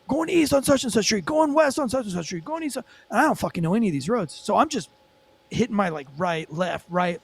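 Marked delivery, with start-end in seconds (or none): 0.1-2.8 high-pitched mimicked pursuit updates; imitated radio callout. Officers calling chase directions over radio, mock urgent callout. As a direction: rattle off directions in a raised voice.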